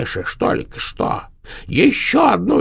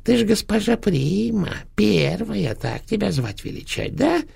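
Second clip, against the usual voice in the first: very high, mocking crone voice; spiteful old hag. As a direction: needle her with fake praise, sing-song. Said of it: "ехидно продолжала старуха".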